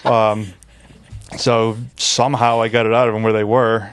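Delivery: usual speaking voice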